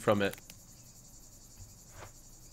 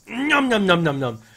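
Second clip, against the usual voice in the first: high playful nom nom chant; munching nom noises; 8 semitones higher, 7 dB louder than usual. Pretend. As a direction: chomp happily in a high voice. Eating sounds while devouring prey.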